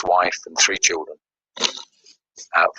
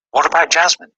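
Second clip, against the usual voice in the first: sharp, much higher cry of alarm; alarmed question; 9 semitones higher, 3 dB louder than usual. Retold or imitated. At the roadside, asking God in sudden alarm. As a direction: blurt it out in shock. Child hit by car.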